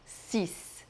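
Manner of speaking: "Six" whispered, barely voiced. Shown as a demonstration. Teacher whispers six for students. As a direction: breathe six softly. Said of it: "Não diga o X no final".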